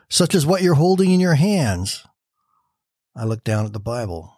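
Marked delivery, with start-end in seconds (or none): 0.1-2.0 gentle insistence. A narrator's reading. Betsy answers Corrie's doubt.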